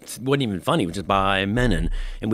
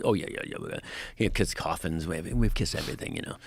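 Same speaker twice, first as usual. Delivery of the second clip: softer, lightly imitated rock star; a hint of a low, quiet impression; 4 semitones lower, 7 dB quieter, faster than usual. Rock star lists his band merchandise, softly imitated. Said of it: "my impression".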